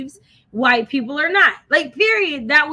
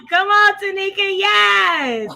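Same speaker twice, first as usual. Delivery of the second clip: hype call; 6 semitones higher, 5 dB louder, slower than usual. Pretend. Hyping up cohost, preacher style.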